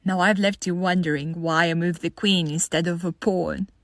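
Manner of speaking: ordinary talk, nothing marked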